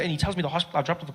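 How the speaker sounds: usual speaking voice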